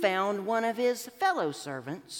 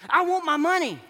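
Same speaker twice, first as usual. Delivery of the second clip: demanding; 7 semitones higher, 7 dB louder, faster than usual. Acted. Creditor barks at the debtor.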